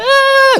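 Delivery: very high mock shriek; comic squeal standing in for screamed Spanish; imitated screaming. Splashed woman screaming in Spanish, high-pitched imitation shriek. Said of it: "she starts speaking Spanish".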